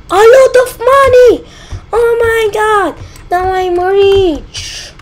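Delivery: high, loud, gleeful shout over the money; greedy money cheer. Pretend. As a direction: crow with delight like a greedy crab.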